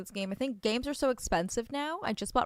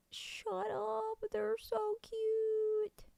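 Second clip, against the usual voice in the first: much higher, drawn-out squeal; squealing over cute; 13 semitones higher, much slower than usual. Real, not acted. Player squeals at tiny creatures running, much higher. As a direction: melt at how cute they are.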